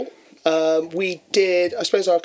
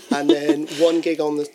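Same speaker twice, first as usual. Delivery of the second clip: normal talking voice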